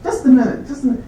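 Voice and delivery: the dean suddenly stopping himself, high; imitating the dean. Dean interrupting himself to check the outer office, high mock voice. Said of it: "And says, uh".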